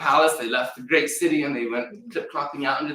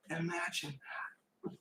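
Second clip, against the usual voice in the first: hushed awe; 4 semitones higher, 16 dB quieter than usual. A narrator's reading. Awed aside.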